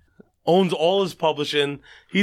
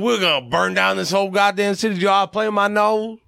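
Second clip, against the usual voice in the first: overdone Southern holler, stretched out at the end; 5 semitones higher than usual. Retold or imitated. Singer celebrating a surprise award win, overdone Southern accent. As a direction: go too far with the Southern twang, slowing at the close. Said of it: "he's, like, a little too Southern".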